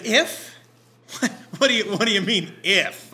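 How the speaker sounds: loud and high, disbelieving; incredulous. Acted. Jesus answering the doubting father, loud and incredulous. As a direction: throw the if back in astonishment. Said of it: "incredulous echo of if".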